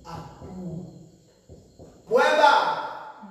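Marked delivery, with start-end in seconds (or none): 2.1-3.2 loud high declaration; emphatic shout. Real, not acted. Preacher closing the argument to the church, loud and high. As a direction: slam the point home.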